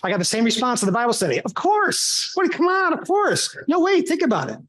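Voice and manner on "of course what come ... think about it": eager protest. Retold or imitated. Bible study response, high.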